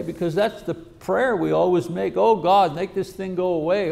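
ordinary talk

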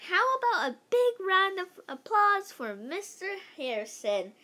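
rally the crowd like a chirpy vice principal; cheery vice principal. Acted. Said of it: "said Missus Jaffe".

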